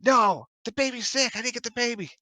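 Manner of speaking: high, pleading protest; game master voicing a frantic mother; distressed woman, acted. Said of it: "And she's like".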